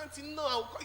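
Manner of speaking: high urgent plea to auntie. Retold or imitated. Begging auntie to wait.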